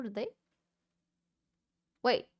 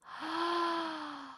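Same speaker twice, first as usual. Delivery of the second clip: gasp; 6 semitones higher, 3 dB quieter than usual. Real, not acted. Snow golem died.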